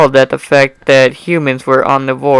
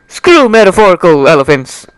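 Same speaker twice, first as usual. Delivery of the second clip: louder, fed-up burst; exasperated outburst; 4 semitones higher, 5 dB louder than usual. Acted. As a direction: throw the phrase away in frustration. Narrator snaps at idioms.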